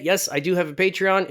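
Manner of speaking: usual speaking voice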